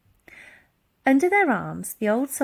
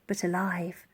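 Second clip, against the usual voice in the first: ordinary delivery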